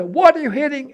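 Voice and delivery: high, loud mock demand; retold alarmed shout. Husband at bedroom door to wife, high retold shout. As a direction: call it out high and loud, re-enacting.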